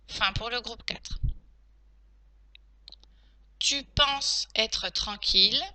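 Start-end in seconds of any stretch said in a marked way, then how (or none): 3.6-5.6 slow, carefully separated words; teacher's slow dictation, rising at the end; dictation pace. Shown as a demonstration. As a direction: dictate it slowly for pupils who are writing. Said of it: "Passons maintenant à la dictée".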